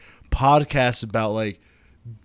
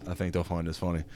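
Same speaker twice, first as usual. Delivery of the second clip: ordinary talk, nothing marked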